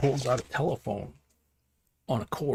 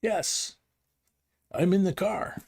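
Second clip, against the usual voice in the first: much higher, proud and bright; excited old man; 10 semitones higher, slower than usual. Retold or imitated. Old man boasting to great grandma from his car phone, high and excited. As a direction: show off the new car phone gleefully. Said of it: "he goes".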